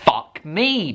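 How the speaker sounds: normal talking voice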